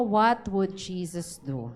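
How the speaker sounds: slow, low, deliberate question; slow modeled question. Shown as a demonstration. Pastor models the question for the congregation, slow. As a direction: ask it slowly as a model. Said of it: "Tanungin mo nga yung katabi mo".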